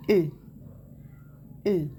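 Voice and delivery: isolated "E", said as a closed French é; é sound demonstrated. Shown as a demonstration. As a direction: say "E" alone as é, with falling tune. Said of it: "Ça se prononce". (« E » prononcé é fermé)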